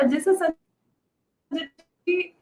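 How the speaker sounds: choppy, dropping-out connection; cutting out like a bad line; radio breaking up. Real, not acted. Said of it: "आपकी आवाज cut रही है।".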